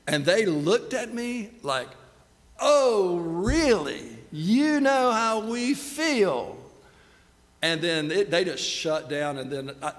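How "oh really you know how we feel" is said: loud, drawn-out sarcastic disbelief; stretched-out, indignant challenge, hammed up; mock incredulity. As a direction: imitate an offended couple, stretching each word high. Offended couple's reaction, acted out.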